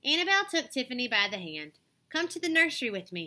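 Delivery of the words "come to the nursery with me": ordinary delivery, nothing marked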